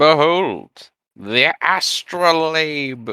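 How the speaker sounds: high, slow grand reveal; grand announcement. Acted. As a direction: proclaim it like a showman.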